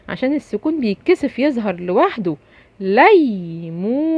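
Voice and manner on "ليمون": "ليمون" drawn out very slowly; slow demo reading. Shown as a demonstration. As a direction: stretch out "ليمون" for the kids. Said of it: "فيها مد بالواو".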